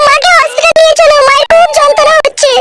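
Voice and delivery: high falsetto, hurried; daughter-in-law to father-in-law, urgent falsetto; urgent young woman. Acted. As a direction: call for help in a girlish voice.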